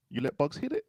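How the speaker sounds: high-pitched shocked question; incredulous. Real, not acted. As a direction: squeak it out in disbelief. Repeats the line in disbelief.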